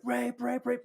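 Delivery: high, small repeated yelp; mock scream. Customer running out of the store, mock screaming. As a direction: imitate screaming at a high pitch without the volume. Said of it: "He just starts screaming".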